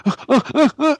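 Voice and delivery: high startled ah ah ah; stunned gasping. Retold or imitated. Two friends watching the disc fly past, high stunned gasps. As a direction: mouth open, staring in shock. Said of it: "we're just sitting there going".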